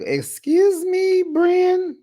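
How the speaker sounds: very high, drawn-out squeal of disbelief; incredulous. Acted. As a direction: shoot up high in outraged disbelief. Reacts to offensive remark.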